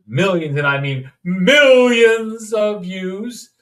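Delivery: loud, high and slow on millions; hammed-up boast about millions; emphatic millions. Exaggerates the view count.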